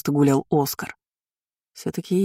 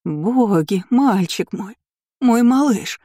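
higher, fussing motherly lament; clucking distress; 6 semitones higher, 3 dB louder, slower than usual. A narrator's reading. Lady hovers helplessly over her son, clucking. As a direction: cluck over a child in dismay. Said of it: "Она кудахтала возле него".

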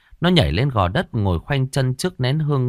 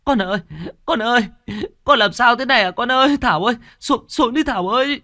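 wailing mother; 14 semitones higher, faster than usual. A narrator's reading. Mother wails at her possessed daughter to come down, high crying plea. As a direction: cry out to the possessed daughter in despair. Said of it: "mẹ nó khóc lóc".

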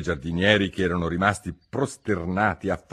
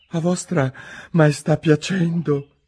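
higher, slow, very humble; humble servant; 9 semitones higher, 3 dB louder, slower than usual. Acted. Il giardiniere implora la regina, umilissimo. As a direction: beg meekly on one knee. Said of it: "con tono che più umile non si può".

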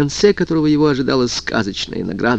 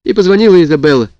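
tearful and rushed; crying on the phone; 6 semitones higher, 4 dB louder than usual. A narrator's reading. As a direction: sob it out over the phone. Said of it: "всхлипывала она в трубку".

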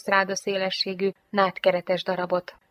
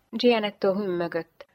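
ordinary delivery

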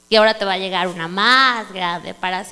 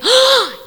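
high loud gasp; amazed gasp; 13 semitones higher, 7 dB louder than usual. Retold or imitated. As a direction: gasp in wonder. Reliving amazement.

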